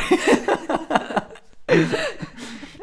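long, loud, high burst of laughter; real uncontrolled laughing fit. Real, not acted. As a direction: laugh helplessly and let it run on. Both crack up over lost structure.

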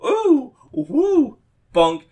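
high, loud mock whoops; silly whooping. Actor milking the bit on stage, high hammy whoops. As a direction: squeal it like a hammy performer milking it. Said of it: "he was, like, going, like".

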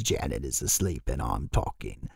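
put-on low, hushed possessing old man; creepy old man impression, sunk down quiet; old man's voice. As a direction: imitate an old man's ghost, low and soft. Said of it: "the old man's voice comes out".

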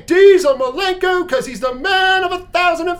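announcer shout of the name; ring announcer yell. Acted. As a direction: bellow the name like a wrestling announcer.

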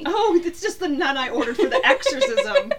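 giggling through the punchline; laughing joke. Real, not acted. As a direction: laugh as you deliver it. Joking quip, laughing.

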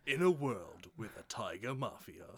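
mock epic trailer line while laughing; in-a-world trailer read; trailer narrator. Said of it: "trailer voice guys".

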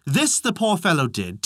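ordinary delivery

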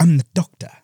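lightly posh British, mock grand; posh English Doctor. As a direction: say it like a proud posh Time Lord. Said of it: "you know how Matt Smith talks".

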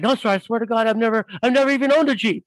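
high-pitched imitation of scared bystanders; mock panicked protest, words tumbling out; wide-eyed denial. Frightened customers deny owning the Jeep to the biker, mock fearful. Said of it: "people's just wide-eyed".

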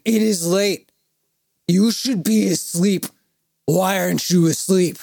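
high, emphatic mock-drunk telling-off; drunk scolding. Drunk fan ordering a singer to sleep, hammed-up scolding. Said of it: "X amount of drinks".

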